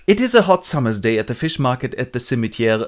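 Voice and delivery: ordinary talk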